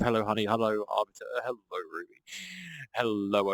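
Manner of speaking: normal talking voice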